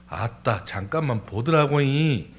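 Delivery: rolling Jeolla intonation; Jeolla dialect. Shown as a demonstration. Excusing oneself as Jeolla people do, Jeolla accent. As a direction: put on a folksy Jeolla accent. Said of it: "전라도는".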